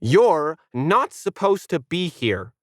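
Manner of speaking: slow, stern huff; slow disapproving dog voice. A narrator's reading. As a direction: scold the intruder slowly. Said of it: "Planet huffed".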